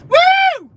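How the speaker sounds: very high, loud whoop; real, piercing celebratory woo; excited woo. Real, not acted. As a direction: let out a wild cheering whoop. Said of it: "Sorry, boys".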